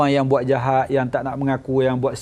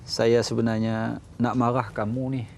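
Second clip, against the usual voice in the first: calm reprimand; 5 semitones lower, 6 dB quieter than usual. Retold or imitated. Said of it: "Dia kata".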